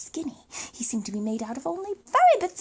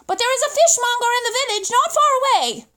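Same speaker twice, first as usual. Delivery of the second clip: louder and higher, projecting upward; calling up; 8 semitones higher, 5 dB louder, faster than usual. Acted. Boy calls up to the pelican about the fishmonger. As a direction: call it up to the high window.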